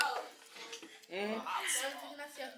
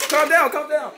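louder, higher repeated calm down; calling out; 6 semitones higher, 5 dB louder than usual. Real, not acted. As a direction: call over the noisy class. Calming rowdy class.

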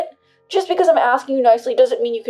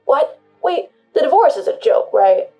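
alarmed disbelief; 4 semitones higher, 9 dB louder than usual. Acted. Husband hears divorce is serious.

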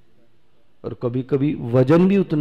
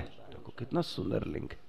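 breathy whisper, fast and hushed; whispered aside; 2 semitones lower, 18 dB quieter, faster than usual. Real, not acted. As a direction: lean in and whisper it quickly.

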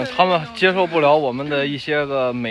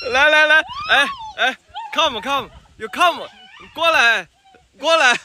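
high, sing-song coaxing call; 12 semitones higher than usual. Real, not acted. Calling children to come close. (高声哄孩子过来)

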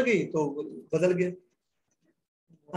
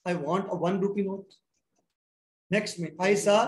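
ordinary talk

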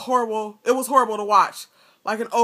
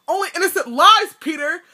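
higher, louder burst of fury; furious; 7 semitones higher, 5 dB louder than usual. A narrator's reading. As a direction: spit it out in rage. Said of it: "terrible fury on his face".

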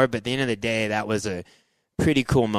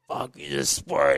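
mild retold anger; 4 dB quieter, much slower than usual. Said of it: "that's when I kinda just got mad".

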